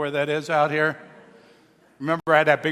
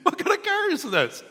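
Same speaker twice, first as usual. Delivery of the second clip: high, disbelieving squeal; incredulous friend; 13 semitones higher than usual. Retold or imitated. Friend laughing at the talking Jeep, high incredulous squeal. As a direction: toss the question up high in mock disbelief. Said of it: "he's just like".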